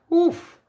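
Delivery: a wincing gasp at something pathetic; cringing oof of second-hand embarrassment; pained oof. Real, not acted. Reacting to his fake receipt.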